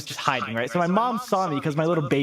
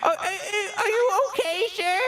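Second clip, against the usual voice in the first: put-on high, wavering old lady voice; a worried grandma checking on a child; mock granny concern; 13 semitones higher than usual. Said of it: "this grandma comes".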